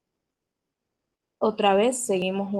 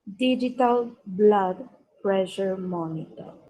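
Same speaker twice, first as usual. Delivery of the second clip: slow phrase in two measured halves; slow dictation. Shown as a demonstration. Teacher giving pupils a last listen, slow dictation. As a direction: draw it out slowly, falling at the end. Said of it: "La voy a pronunciar lentamente".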